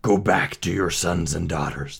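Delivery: gruff, growling monster command; rough creature voice, commanding; roaring beast. A narrator's reading. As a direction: dismiss him with a beastly growl. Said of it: "the beast roared".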